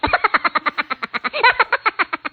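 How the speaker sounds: real laugh at a recording mishap. Real, not acted. Teacher laughing as her light fails mid-recording. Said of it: "Caiu a minha luz aqui".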